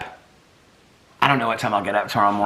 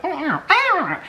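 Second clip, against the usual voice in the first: very high little yips; imitating puppy yips; slower than usual. Owner copies her puppies' talking noises, high yips. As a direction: mimic two puppies chattering back and forth. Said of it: "like, back and forth where they".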